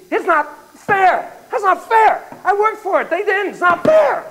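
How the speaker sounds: high, squealing outraged monkey; indignant monkey. Acted. Cheated monkey protesting to researcher, high indignant squeal. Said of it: "it'd be".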